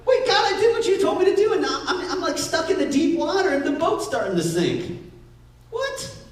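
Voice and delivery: desperate wait-what to God; panicked protest. Retold or imitated. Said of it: "And we're like".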